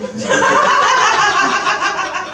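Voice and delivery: long high airy laugh; breathy laugh. Real, not acted. Comedian laughing at own edibles joke, high airy laugh. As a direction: laugh at your own punchline.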